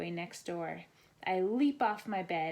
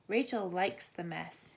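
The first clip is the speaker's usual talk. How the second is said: ordinary delivery, nothing marked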